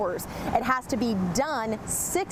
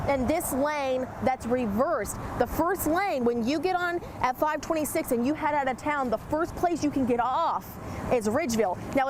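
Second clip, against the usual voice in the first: ordinary talk, nothing marked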